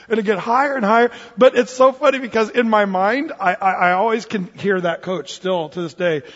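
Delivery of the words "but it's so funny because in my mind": usual speaking voice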